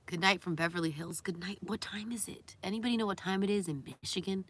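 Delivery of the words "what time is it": whisper. Real, not acted. Whispered aside.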